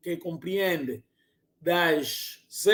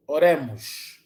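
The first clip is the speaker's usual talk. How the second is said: usual speaking voice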